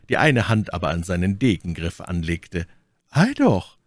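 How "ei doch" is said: high, sudden check; exclamation. A narrator's reading. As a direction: call out to halt an attacker. Baron checks her leap. (hoher, abwehrender Ausruf)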